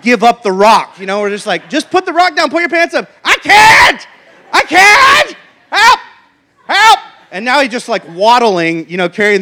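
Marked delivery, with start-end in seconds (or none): 1.7-3.1 calling out. Retold or imitated. Parents calling to son, a raised exasperated call. 3.2-7.0 put-on high, loud childlike wailing shouts; desperate kid. Son refusing to drop the rock, high panicked shouts.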